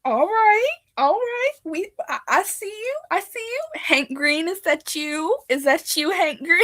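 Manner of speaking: thrilled to spot someone in chat; excited. Real, not acted. Greeting Hank Green in the livestream chat, excited.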